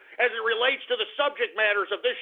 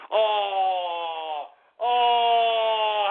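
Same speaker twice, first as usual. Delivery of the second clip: long drawn-out "oh". Real, not acted. Host reacting to Sanders joining Clinton, gloating drawn-out "oh". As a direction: hold each "oh" long and level.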